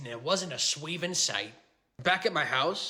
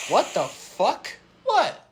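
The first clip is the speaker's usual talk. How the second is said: shocked; 6 semitones higher, 5 dB louder, slower than usual. Acted. Shocked by the opponent's card.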